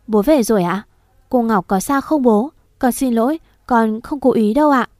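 ordinary delivery, nothing marked

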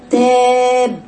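تاب slow with a tilted vowel; wrong pronunciation. Shown as a demonstration. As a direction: drawl تاب with a leaning a. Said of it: "تاب خطأ".

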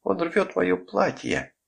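high, drawn-out panicked cry; losing self-control. A narrator's reading. Mother to her husband as the child tears her dress, high panicked wail. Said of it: "Катерина теряла самообладание".

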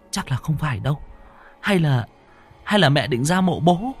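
high, hesitant sister's voice; younger sister, uneasy reassurance. Acted. Sister suggests they watch over mother, hesitant reassurance.

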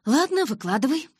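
high, loud grudging concession; sighing concession. A narrator's reading. Narrator letting Калин have his say, sighing concession. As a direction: give in with exasperation. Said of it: "вздохнула я".

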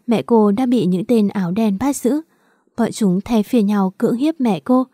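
ordinary delivery, nothing marked

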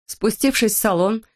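plain reading voice

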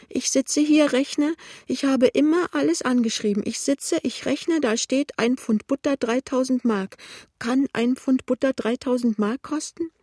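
put-on high, agitated old woman's lament; pitiful. A narrator's reading. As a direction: wail it high, bewildered. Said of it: "sagt die alte Frau kläglich". (klägliche, hohe Altfrauenstimme)

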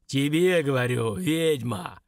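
heavy command, first word punched; ominous, commanding boom; stern deep voice. A narrator's reading. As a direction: order it sternly, with menace. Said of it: "строго приказал голос".

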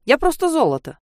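high and playful boast; tease, pleased with yourself. A narrator's reading. Playful self-praise.

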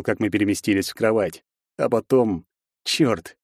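plain reading voice

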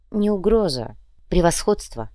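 usual speaking voice

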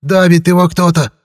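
high, loud desperate yell; old man's shout. A narrator's reading. Grandpa Silanty yells at the wizard to act, loud. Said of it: "крик деда Силантия".

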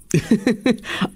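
high-pitched acted laugh; bursting into laughter. Pramatha scoffing at talk of ghosts, a high laugh. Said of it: "Pramatha হেসে উঠল".

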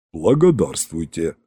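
low, soft boom of thanks; droning low character voice; low rumble. A narrator's reading. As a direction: rumble it deep in the chest. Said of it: "прогудел Иван".

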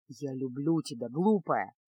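ниже и тише, устало и виновато; загнанный в угол юноша признаётся; подавленный Кайл. A narrator's reading. Кайл нежно утешает. (ниже и тише, подавленный мужской голос)

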